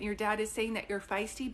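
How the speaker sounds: ordinary talk, nothing marked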